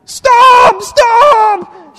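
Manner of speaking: high, drawn-out shout of alarm; yelling. Retold or imitated. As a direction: yell it at a driver to halt. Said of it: "yelling at me".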